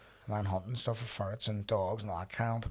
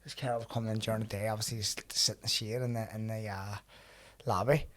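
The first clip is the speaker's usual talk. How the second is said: ordinary talk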